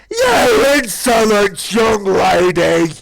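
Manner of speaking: very loud, very slow roaring cry; furious dragon shout. Acted. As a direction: bellow it in outrage. Dragon scolding, escalated.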